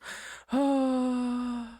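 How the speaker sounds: long, high, showy sigh; exaggerated loud sigh. A narrator's reading. Receptionist rushed by a visitor, unnecessarily loud sigh. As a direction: let out a needlessly big sigh. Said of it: "an unnecessarily loud sigh".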